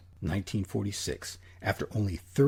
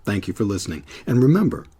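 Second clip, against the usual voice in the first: brisk, louder announcer read of the outro; closing credits read; 7 dB louder than usual. Acted. Producer thanks listeners and signs off, announcer read. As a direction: wrap up the show like a radio host.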